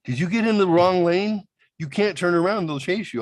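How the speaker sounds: high, alarmed mock panic; panicked friend. Lisa warning him on the phone, high and alarmed. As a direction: warn him in a high rush.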